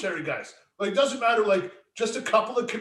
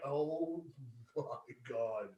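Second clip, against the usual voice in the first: much softer, drawn-out oh my God; stunned aside; 7 semitones lower, 14 dB quieter, much slower than usual. Retold or imitated. Host retelling his reaction to Flair, soft stunned. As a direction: let it fall out slowly in disbelief. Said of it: "I'm like".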